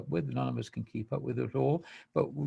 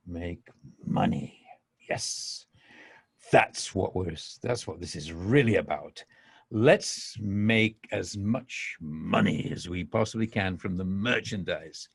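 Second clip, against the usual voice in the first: mock greedy; 8 semitones lower than usual. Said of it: "Just had to throw that in as a little laugh and a joke".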